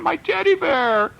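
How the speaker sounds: put-on high, loud child's scream; storyteller shrieking as a little girl. A narrator's reading. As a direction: wail like a small girl losing her teddy. Little girl protests loss of toys.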